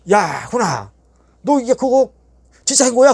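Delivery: 높고 크게 치솟는 확인; 믿기지 않아 되묻기; 놀란 되물음. Retold or imitated. 형님이 놀라 다시 확인. (놀란 형님이 높이 되묻는 목소리)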